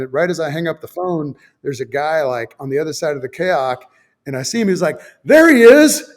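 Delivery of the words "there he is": calling out. Retold or imitated. Guy spots him, calls out.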